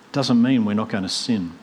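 ordinary delivery

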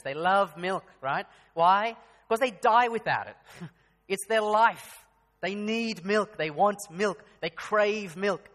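rising passionate sermon voice; full-force preaching. Acted. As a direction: drive the point home to the congregation.

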